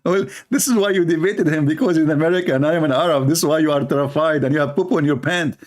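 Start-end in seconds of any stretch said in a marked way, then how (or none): none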